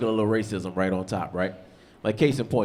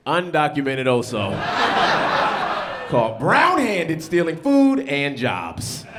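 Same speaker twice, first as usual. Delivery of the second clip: mocking news anchor; 2 semitones higher, 3 dB louder, much slower than usual. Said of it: "He'd have been like".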